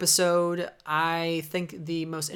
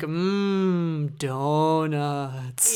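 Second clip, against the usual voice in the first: drawn-out donut craving; hum, then stretch the word dreamily; Homer Simpson; much slower than usual. Retold or imitated. Simpsons fan quoting the donut line, drawn-out craving. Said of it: "It was like a Homer Simpson moment".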